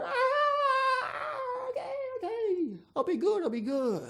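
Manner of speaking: put-on very high, wailing child's cry; a frightened boy begging the priest, mock-crying; scared little boy. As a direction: blubber out a panicked promise. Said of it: "the poor little Johnny goes".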